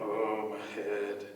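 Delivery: low, dazed groan; hurt snake coming round. Acted. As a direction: moan it deep and woozy.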